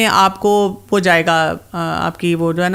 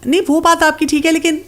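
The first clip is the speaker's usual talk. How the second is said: higher, half-laughing mother's protest; imitating a flustered mother; 6 semitones higher than usual. Mother protesting to the doctor, high and half-laughing. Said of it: "پھر وہ کہتی ہیں".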